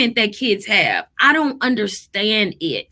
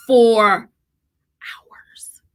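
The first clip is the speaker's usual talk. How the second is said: whisper; 4 dB louder than usual. Real, not acted. Mom telling listeners about the meltdown, whispered. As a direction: drop to a whisper on "for hours".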